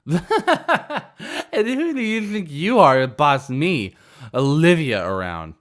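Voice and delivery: high, loud, giggling through the words; drunk laughing. Acted. Drunk girl laughing at the owner, high and loud. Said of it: "laughing hysterically".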